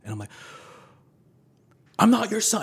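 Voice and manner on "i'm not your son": sudden high shout; high-pitched yell of denial. Retold or imitated. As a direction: yell it at her through the door. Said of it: "I start yelling at her".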